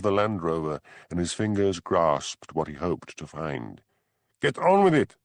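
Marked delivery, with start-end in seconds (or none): 4.4-5.2 high, loud impatient yell; impatient shout. A narrator's reading. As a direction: bark at them to hurry up. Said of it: "shouted the German".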